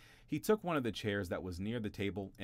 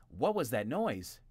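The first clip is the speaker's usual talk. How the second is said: louder, higher, a bit slow; brothers asking; 5 semitones higher, 5 dB louder, slower than usual. A narrator's reading. Brothers entering the kitchen ask loudly. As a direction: call out to find the noise. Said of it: "they asked".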